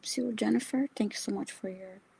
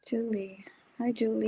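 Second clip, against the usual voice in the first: whispering; 4 semitones higher than usual. Real, not acted. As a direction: whisper hello. Greeting in whisper.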